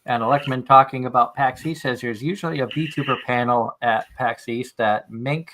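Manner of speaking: ordinary talk, nothing marked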